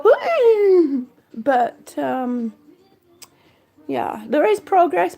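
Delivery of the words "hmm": high, louder reacting "hmm"; doubtful hum. Imitating viewers seeing the messy progress, doubtful hum. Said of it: "might make you go".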